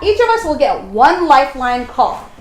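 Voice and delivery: usual speaking voice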